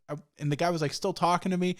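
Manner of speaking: ordinary talk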